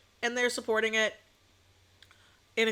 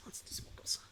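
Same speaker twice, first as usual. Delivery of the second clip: soft playful whisper; 16 dB quieter than usual. Real, not acted. Streamer whispering a playful aside to chat. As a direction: whisper it like a secret.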